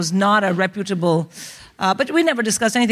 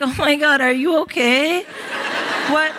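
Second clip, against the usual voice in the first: American roommate; 5 semitones higher than usual. Retold or imitated. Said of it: "I had an American roommate".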